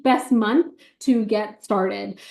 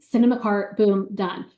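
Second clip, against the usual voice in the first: ordinary talk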